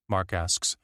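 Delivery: plain reading voice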